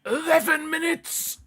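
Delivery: very high, slow, disbelieving squeal; shocked shriek. Real, not acted. Fan shrieking at the stoppage time board. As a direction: shriek it as if the number can't be real.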